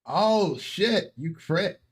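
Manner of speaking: high, loud astonished outburst; real surprise. Real, not acted. Game master reacting to a player's critical roll, real surprise.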